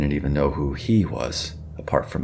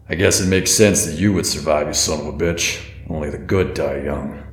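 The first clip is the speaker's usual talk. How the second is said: a hint of a gruff, bitter stranger, louder; gruff bitter man; 4 dB louder than usual. A narrator's reading. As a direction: spit it out with malice. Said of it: "The gruff man".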